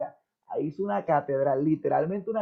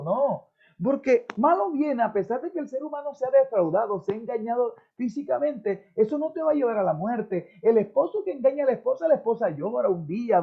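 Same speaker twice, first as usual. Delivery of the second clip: fervent preaching; 6 semitones higher than usual. Acted. Pastor exhorts his congregation, preaching with fervent full force. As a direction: lift the congregation, full pulpit fire.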